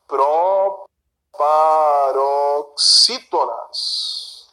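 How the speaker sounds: very slow, stretched syllables on "Proparoxítonas"; "Proparoxítonas" stretched slowly, syllable after syllable; drawn out. Shown as a demonstration. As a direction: draw out "Proparoxítonas" chunk by chunk for the class. Teacher spells out the key term.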